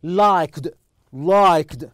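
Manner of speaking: "Liked" said loudly with a clear final d sound; final D pronounced. Shown as a demonstration. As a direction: demonstrate "Liked", landing on just a d at the end. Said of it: "نطقت وشه ديب فقط".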